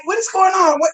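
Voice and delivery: startled. Retold or imitated. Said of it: "I'm like".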